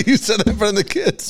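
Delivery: laughing it out high; real laughter over the line. Real, not acted. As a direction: laugh through the words in disbelief. Laughing in disbelief at story.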